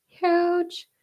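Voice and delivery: Huge. jumps way up high in a squeal; Huge. thrown up into a high squeak; squeaky high huge. Shown as a demonstration.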